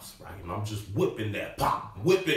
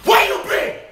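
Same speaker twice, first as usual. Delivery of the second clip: high, loud furious shout; yelling at dad; 10 dB louder than usual. Retold or imitated. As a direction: scream it at the long-gone father. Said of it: "I'm like".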